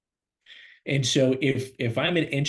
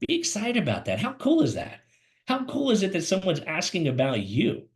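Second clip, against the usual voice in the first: much higher, bright burst of enthusiasm; excited; 6 semitones higher than usual. Shown as a demonstration. Coach showing candidates how to sound excited, much higher. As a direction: pump it up, sell the thrill. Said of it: "you should be excited about telling them".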